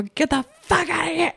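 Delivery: high-pitched mock yell; mock angry dismissal. Hunters chasing Chert away, mock angry yell. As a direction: drive him off in a hammed-up squawk.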